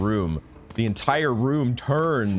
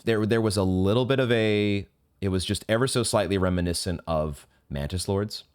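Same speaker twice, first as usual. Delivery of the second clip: normal talking voice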